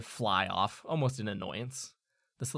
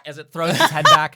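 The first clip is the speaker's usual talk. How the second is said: normal talking voice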